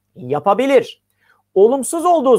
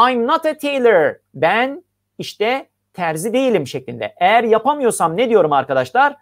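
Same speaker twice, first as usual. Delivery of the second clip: ordinary delivery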